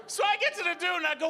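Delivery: ordinary delivery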